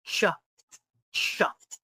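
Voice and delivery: "chuffed" as one syllable, "chuft"; T ending. Shown as a demonstration. Teacher models the word for learners, "chuffed" ending in T. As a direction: close "chuffed" with a T sound. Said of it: "So the E D becomes T".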